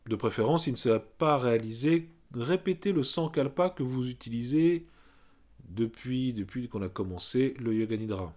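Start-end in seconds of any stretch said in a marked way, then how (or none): none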